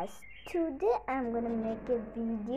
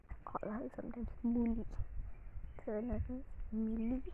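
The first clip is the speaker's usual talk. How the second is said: fast unvoiced whisper; quick whispered aside to viewers; hushed quick explanation; 2 semitones lower, 5 dB quieter, faster than usual. Real, not acted. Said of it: "whispered aside".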